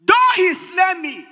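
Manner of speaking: loud, slow scripture cry; ringing Bible quote; proclaimed scripture. Acted. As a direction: declare the verse with full force. Preacher quotes Job loudly.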